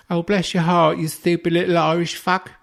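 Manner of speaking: high, loud, hammed-up condescending lady; mock English woman. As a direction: sneer sweetly like a patronising English woman. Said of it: "mock of the English woman".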